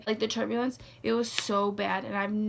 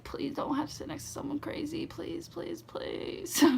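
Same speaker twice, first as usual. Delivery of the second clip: hushed, desperate little prayer under the breath; whispered plea walking down the aisle; pleading whisper; 4 semitones higher, 7 dB quieter than usual. Retold or imitated. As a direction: beg softly so nobody hears. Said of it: "I'm like".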